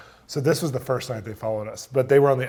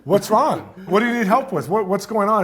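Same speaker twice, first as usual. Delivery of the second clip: raised, louder voice, firing questions; alarmed questioning; 8 semitones higher, 6 dB louder than usual. Retold or imitated. Woken man asking his assistant what's wrong, loud alarm. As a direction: act out startled questions to a stricken friend. Panicked reply to entranced assistant retold.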